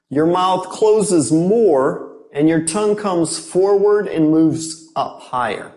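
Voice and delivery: ordinary delivery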